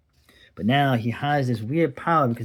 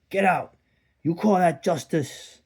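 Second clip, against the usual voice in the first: high, raised voice, last words slow; a boss throwing the kid out, high and raised; angry boss; 6 semitones higher than usual. Acted. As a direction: throw him out like an indignant boss. Comic boss throws him out.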